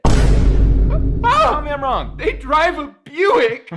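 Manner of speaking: high, loud gleeful taunt; a kid delighted by a savage roast; roast reaction. Acted. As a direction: crow over the burn. Kid roasting teacher's insult.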